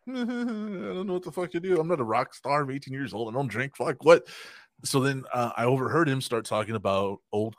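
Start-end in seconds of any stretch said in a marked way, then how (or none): 0.1-4.2 a hint of nervous panic, pitched up at first; flustered, half-laughing self-mockery; panicked inner voice. Retelling his teen self freezing near [name], mock panic.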